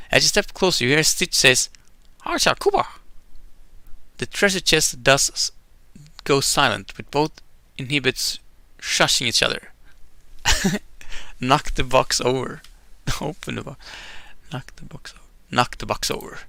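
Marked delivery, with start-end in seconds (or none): 2.2-2.9 much higher squeaky alien voice; high alien babble; Stitch alien gibberish. Acted. Voicing Stitch's alien words. 14.5-15.2 hushed under-breath suggestion; whispered. Real, not acted. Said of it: "repeated choice".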